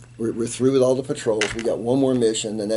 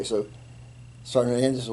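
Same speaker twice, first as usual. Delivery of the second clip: ordinary talk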